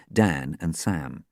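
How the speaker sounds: ordinary delivery, nothing marked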